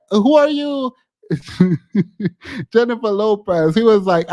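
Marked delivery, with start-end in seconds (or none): 0.1-0.9 mock snooty who. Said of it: "He's like".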